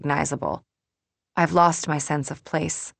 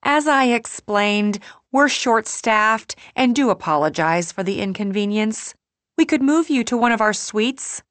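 higher-pitched, placating customer-service voice; mortified apology; 6 semitones higher, 3 dB louder than usual. A narrator's reading. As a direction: apologise to an angry guest, mortified. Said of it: "looking mortified".

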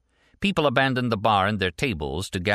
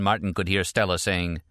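ordinary delivery

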